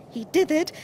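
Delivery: usual speaking voice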